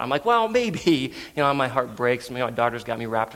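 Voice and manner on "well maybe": higher-pitched, wavering maybe; hopeful pleading. Retold or imitated. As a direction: plead lightly, testing the waters. Father wavering to wife.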